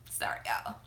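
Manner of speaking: choked whispered apology; tearful whisper. Real, not acted. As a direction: whisper it through real tears. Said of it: "now I'm finna start crying".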